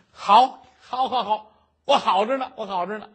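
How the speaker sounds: loud, high, happy repeats; a scholar loudly overjoyed to be greeted. Acted. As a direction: blurt it out with joy.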